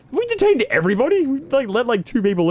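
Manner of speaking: high, fast excited burst. Real, not acted. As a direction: rush it out excitedly. Sudden high excited question.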